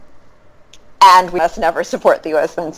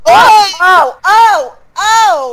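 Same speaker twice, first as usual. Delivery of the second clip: excited shouting; 11 semitones higher, 4 dB louder, much slower than usual. Real, not acted. Reacting to Bradley remark.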